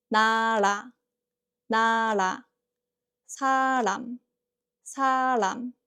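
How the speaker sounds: lightly tapped ㄹ between vowels in 다리, 나라, 사람, 여름, 우리; tapped ㄹ demonstration. Shown as a demonstration. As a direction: model each word carefully, first syllable held, then a light ㄹ tap. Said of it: "where the next syllable starts with 리을".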